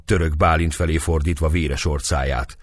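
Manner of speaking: usual speaking voice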